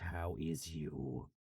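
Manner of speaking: put-on low, slow pirate accent; pirate drawl, pitched well down. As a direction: drag it out deep like a pirate. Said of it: "I don't know why I'm in a pirate accent".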